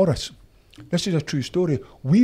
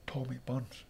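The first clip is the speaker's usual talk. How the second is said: Tommy Burns; 15 dB quieter than usual. Retold or imitated. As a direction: give the name quietly down the line. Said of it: "that Glaswegian accent".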